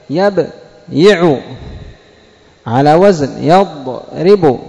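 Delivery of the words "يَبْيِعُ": يبيع said slowly as yab-yi-ʿu; original form yabyiʿu. Shown as a demonstration. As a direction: stretch يبيع with the y sounded. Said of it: "وأصل يبيع". (يبيع على الأصل: يَبْيِعُ)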